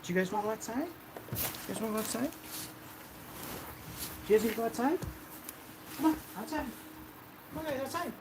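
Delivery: high, soft pet-talk voice; coaxing pets. Real, not acted. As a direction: coax the dogs toward the door. Asking dogs to go outside.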